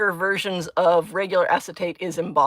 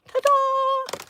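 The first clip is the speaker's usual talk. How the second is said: much higher, sing-song ta-da; ta-da reveal. Real, not acted. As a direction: show off the finished piece proudly. Presenting the finished frog.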